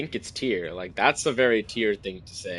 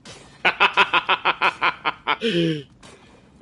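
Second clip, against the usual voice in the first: high staccato run of laughter; real, sustained fit of giggles; long laugh; 7 semitones higher than usual. Real, not acted. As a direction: laugh on and on in quick pulses. Cracks up at the loop.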